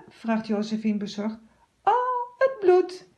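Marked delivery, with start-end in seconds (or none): 1.8-3.1 put-on high, louder thrilled girl; delighted cry. A narrator's reading. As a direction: gasp with delight at the blood. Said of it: "roept ze dan, verrukt". (verrukt hoog uitroepen)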